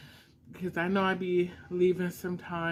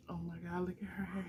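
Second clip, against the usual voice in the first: soft awe; 9 dB quieter, much faster than usual. Real, not acted.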